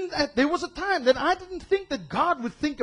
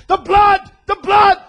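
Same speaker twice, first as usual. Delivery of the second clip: higher, loud, desperate cry; hammed-up frantic shout; dramatic pleading shout; 9 semitones higher, 13 dB louder than usual. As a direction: throw yourself in between, yelling. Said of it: "Jesus jumps in between".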